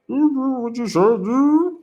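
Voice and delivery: high-pitched mumbling mimicry; mocking imitation. Mimics tongue-tied man in clip.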